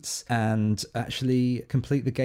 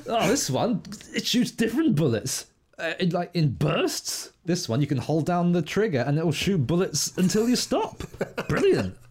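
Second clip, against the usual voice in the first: mock excited gamer; 6 semitones higher than usual. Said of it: "I'm doing a bit".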